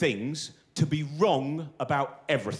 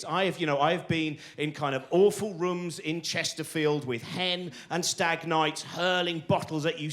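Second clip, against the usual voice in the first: ordinary talk, nothing marked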